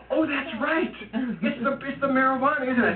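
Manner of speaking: high-pitched stoned-mom delight; giddy realization. Retold or imitated. Stoned mother is reminded by her son, giddy. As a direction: leap up high as it dawns on her. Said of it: "And she starts laughing again".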